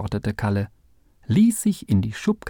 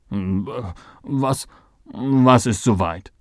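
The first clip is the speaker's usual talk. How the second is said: aus dem Schlaf aufgeschreckt; verschlafen; much slower than usual. A narrator's reading. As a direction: schlaftrunken und verdattert nachfragen. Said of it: "Völlig verschlafen fuhr Theo in die Höhe". (leicht benommen, gerade erst geweckt)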